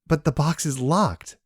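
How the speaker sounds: high, slow whine; whining. A narrator's reading. Yumi whining to the steward that the box is locked. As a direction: complain like a thwarted child. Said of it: "Yumi whined".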